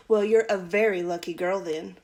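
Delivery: usual speaking voice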